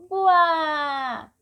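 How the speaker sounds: a hammed-up buá like a crying child; playful sob sound buá; mock crying wail. Shown as a demonstration. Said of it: "ruído de choro".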